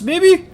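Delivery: "maybe" shooting up high at the end; questioning. Acted. Father unsure of his own claim, "maybe" lifted into a high question. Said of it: "so many fucking question marks".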